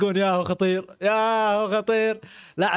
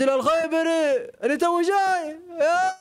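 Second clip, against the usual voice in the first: high, laughing naming of the player; words squeezed through laughter; 10 semitones higher, slower than usual. Real, not acted. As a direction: laugh as you say his name.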